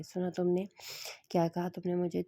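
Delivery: ordinary delivery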